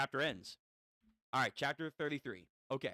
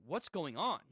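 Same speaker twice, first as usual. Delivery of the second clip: usual speaking voice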